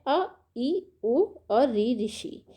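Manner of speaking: slowly spaced, each short vowel said on its own; teacher sounding out the short vowels one by one, slowly; slow classroom recitation of the short vowels; slow vowel demo. Shown as a demonstration. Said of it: "इनके उच्चारण में ना बहुत ही कम समय लगता है".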